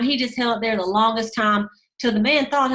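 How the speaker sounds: usual speaking voice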